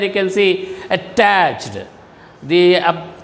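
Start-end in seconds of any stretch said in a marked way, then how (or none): 0.9-1.8 "attached" with the stress on "-tached"; stress on second syllable. Shown as a demonstration. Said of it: "stress is marked there".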